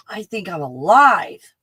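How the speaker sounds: ordinary talk, nothing marked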